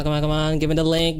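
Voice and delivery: usual speaking voice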